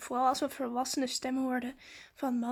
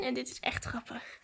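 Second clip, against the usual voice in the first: hushed amused aside; whisper; 2 semitones lower, 6 dB quieter than usual. Real, not acted. Vlogger whispering to viewers beside the walkie-talkie. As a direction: whisper it so the strangers can't hear.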